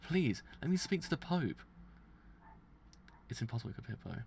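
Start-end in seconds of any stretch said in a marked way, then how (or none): 0.1-1.6 desperate plea. Acted. Begging louder against exile.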